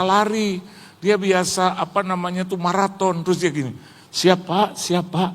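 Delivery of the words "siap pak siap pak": high-pitched mocking prod; imitating a young man's taunt; teasing challenge. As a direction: needle him, voice pitched up. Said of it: "Dia kayak ngeledek saya gitu".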